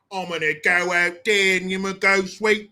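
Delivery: hammed-up Cockney accent, twisted vowels; chimney sweep Cockney. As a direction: parody a London chimney sweep. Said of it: "talk like the chimney sweep from Mary Poppins".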